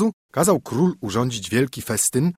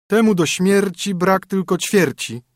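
high, teasing sing-song rhyme; a haughty princess mocking a fat knight in verse; princess mocking a suitor; 9 semitones higher, 4 dB louder than usual. A narrator's reading. Princess sneering at a suitor, teasing and high.

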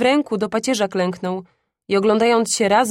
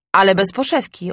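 plain reading voice

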